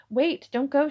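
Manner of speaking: loud, urgent plea to stay; pleading. Acted. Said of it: "he pleaded".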